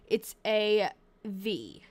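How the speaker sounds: ordinary talk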